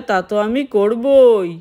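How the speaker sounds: ordinary delivery, nothing marked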